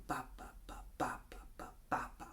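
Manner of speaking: whispered ba ba ba in time; whispered rhythm. Shown as a demonstration. Teacher whispering the strum feel, softly. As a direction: whisper the strum rhythm under your breath. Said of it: "get that feel going".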